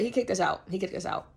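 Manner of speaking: ordinary talk, nothing marked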